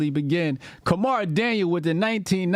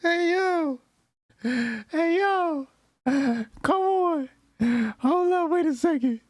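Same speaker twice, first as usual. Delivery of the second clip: much higher, calling out in disbelief; high-pitched protest; 10 semitones higher than usual. Real, not acted. Reactor calling out the streamer in disbelief. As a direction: yelp it up high.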